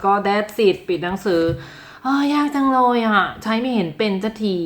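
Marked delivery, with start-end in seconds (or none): 1.7-4.6 mock frustrated whine. Learner closing grammar book in defeat, mock whine.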